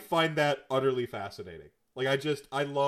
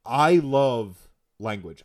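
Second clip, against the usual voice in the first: emphatic; 9 dB louder, much slower than usual. Real, not acted. Repeats declaration, slowly and loudly.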